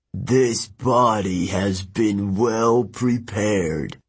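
slow, razor-cold ancient voice; Kronos. Acted. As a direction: speak coldly, drawing every word out like a blade. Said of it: "voice was like a razor blade".

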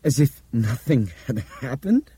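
high, slow, disbelieving question; incredulous. A narrator's reading. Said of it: "Bob asked incredulously".

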